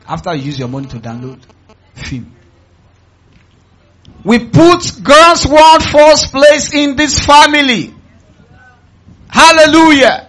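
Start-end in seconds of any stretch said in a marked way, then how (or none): 4.2-10.2 high, slow, loud preaching shout; loud proclamation. Real, not acted. Preacher declaring the family's creed to the congregation, loud proclamation. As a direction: proclaim it at full volume, drawn out.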